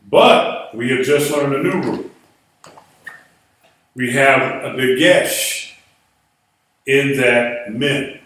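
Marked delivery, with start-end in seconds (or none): none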